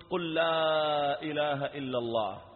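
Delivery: drawn-out, steady urging; slow, sustained call; slow prompting. Retold or imitated. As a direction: stretch it out for a dying man to follow. Prompting second dying man.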